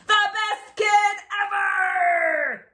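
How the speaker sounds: loud proud announcement; proclaiming loudly. Acted. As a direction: announce it grandly.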